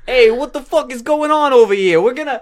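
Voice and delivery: loud, high hammed-up New York Italian; brash mock New York Italian; New York Italian accent. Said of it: "New York Italian".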